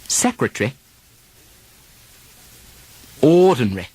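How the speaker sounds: Secretary and Ordinary with the -ary vowel dropped; British pronunciation. Shown as a demonstration. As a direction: stress the first syllable British-style. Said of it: "an earlier syllable now gets the stress".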